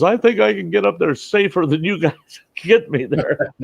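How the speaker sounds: high, stubborn voice for the injured guy; mock stubborn patient. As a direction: imitate the heavy patient insisting he can manage. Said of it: "He goes".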